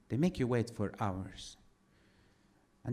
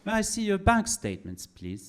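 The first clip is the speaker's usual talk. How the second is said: polite British official asking for papers; mock British bureaucrat, syrupy courtesy; British accent; 9 semitones higher, 4 dB louder than usual. British accent, embassy officer grilling Trump over papers. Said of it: "I'm doing a British accent".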